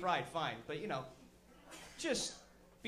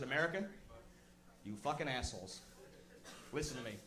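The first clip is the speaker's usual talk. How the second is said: threatening president; 7 semitones lower, 6 dB quieter than usual. Retold or imitated. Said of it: "I would have come out and said".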